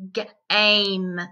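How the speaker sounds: G-, aim split into onset and rime; segmented word. Shown as a demonstration. Teacher splitting a word for young learners, sounded out. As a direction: say G- then aim as a separate chunk. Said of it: "Do we hear short vowel".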